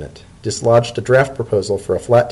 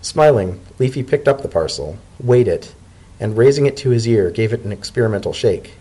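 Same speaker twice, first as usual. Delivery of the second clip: ordinary delivery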